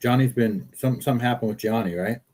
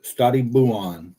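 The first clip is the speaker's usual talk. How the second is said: slow Stati buon with Stati stressed; mock Italian farewell; Italian phrase; slower than usual. Shown as a demonstration. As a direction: lean on Stati, stretch buon. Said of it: "Stay well, cousin, is what that means".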